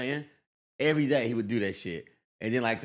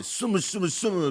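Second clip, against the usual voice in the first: mocking mumble; 7 semitones higher than usual. Classmate taunting him in class: higher mocking chant. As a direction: tease the speaker's accent. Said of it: "he would just start making that noise".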